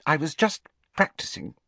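high, brittle explanation. A narrator's reading. Hermione explains her conjured birds to Harry, brittle voice. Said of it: "she said in a brittle voice".

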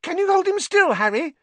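high, loud, eager request; fascinated young fan. A narrator's reading. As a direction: beg excitedly in a boy's piping voice. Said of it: "fascinated, and raising his camera".

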